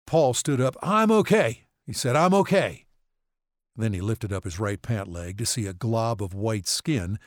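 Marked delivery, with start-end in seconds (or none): 0.8-1.7 high, loud insistence; insisting loudly. Acted. Paul insists after fall. 2.2-2.8 high, loud repeated insistence; insisting again. Acted. Paul repeats reassurance.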